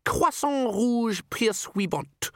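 high, slow, grand French title; old herald. Acted. Herald introducing himself to Vimes, high stately voice. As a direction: announce it high and drawn out.

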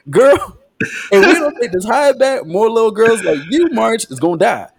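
high, worked-up talking-to; hammed-up exasperated pleading; urgent mock warning. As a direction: drive the point home to a stubborn girl. Acts out Perona warning March.

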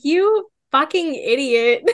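slow, drawn-out disbelief; exasperated mock insult. Reader scolding a foolish character, slow and exasperated. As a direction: drag it out like you can't believe him.

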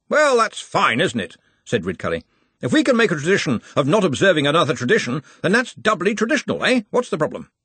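a hint of a booming Arch-Chancellor; Ridcully, hearty. A narrator's reading. Ridcully voiced by reader.

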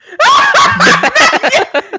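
high, loud shrieking laugh. Real, not acted. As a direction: crack up out loud. Laughing at friend's joke.

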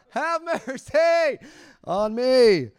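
high, slow, drawn-out cry for mercy; desperate plea to God. Retold or imitated. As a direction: stretch out a pleading cry heavenward. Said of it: "staring out the window looking at God".

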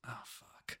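voiceless, very soft mock resignation; caught guy's resignation. Retelling the guy getting caught, hushed resignation. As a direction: let the curse out under your breath. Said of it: "the guy just goes".

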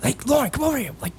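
high, fake-puzzled luring voice; feigned innocent call. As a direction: act baffled to bait her over.

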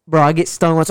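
ordinary delivery

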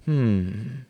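a long, thoughtful hum on a sigh. A narrator's reading. Tom listening to his distressed friend, sighing. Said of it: "sighed Tom".

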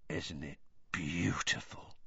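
breathless awed whisper; soft whisper. A narrator's reading. Hagrid gazing at the dragon, awed whisper. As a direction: whisper it in wonder. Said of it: "said Hagrid softly".

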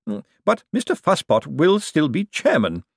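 usual speaking voice

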